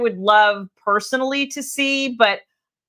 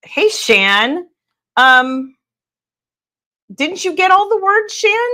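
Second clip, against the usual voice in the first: much higher, teasing call across the room; mock surprised call; 8 semitones higher than usual. Real, not acted. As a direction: call out to Shan in playful surprise. Calling out to a friend.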